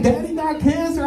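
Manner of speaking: put-on high panicked child yelling; panicked kid cry. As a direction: shriek it like a scared little boy. Son running off yelling.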